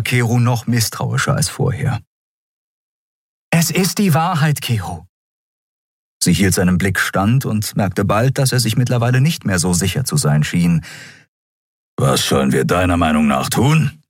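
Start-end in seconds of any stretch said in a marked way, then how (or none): 3.5-5.1 high start, dropping on the name; insist, then soften at the end; earnest insistence. Acted. Endriel assures distrustful Keru of the truth, earnest. 12.0-14.0 gruff, grudging question; growling Keru. Acted. Keru finally asks Endriel what to do, growling. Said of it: "knurrte er schließlich".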